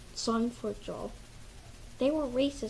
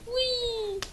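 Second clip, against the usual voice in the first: gleeful whee; 10 semitones higher than usual. Acted. Characters rushing off.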